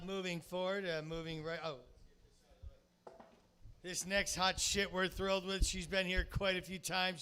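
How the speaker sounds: usual speaking voice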